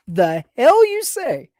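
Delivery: slow, disbelieving retort; incredulous. Real, not acted. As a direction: scoff at the claim slowly.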